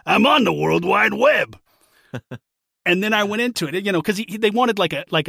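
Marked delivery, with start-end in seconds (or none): 0.1-1.6 loud, slow punchline, hammy comic; milk the dad joke punchline; old borscht belt comic. Retold or imitated. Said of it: "a cheesy, you know, dad joke comedian".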